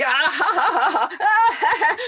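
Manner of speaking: high hearty fake laughing; forced laughter yoga laugh. Shown as a demonstration. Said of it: "And I'm like".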